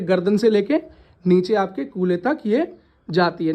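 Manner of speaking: slowed down, spelling it out for the class; drawing out each word for the students. Shown as a demonstration. Teacher explaining the spine slowly.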